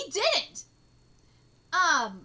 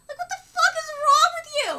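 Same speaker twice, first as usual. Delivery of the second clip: much higher and louder; angry shout; 9 semitones higher, 9 dB louder than usual. Real, not acted. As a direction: yell at him in disbelief. Angry reaction to the character.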